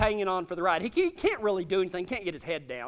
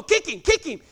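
raised, higher urging; urgent command; 5 semitones higher, 4 dB louder than usual. Retold or imitated. Cowboy to the rider starting the colt down the fence, urgent command. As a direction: urge the rider on, voice lifted. Rider's partner orders him to kick.

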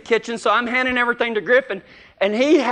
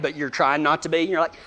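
ordinary delivery

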